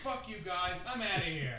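calling from across the room; shouted from a distance; far off. Pretend. Leaving the room in mock huff.

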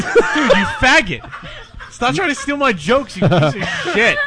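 high, laughing fake outrage; hammed-up indignant rant; mock angry. Mock outrage at stolen joke.

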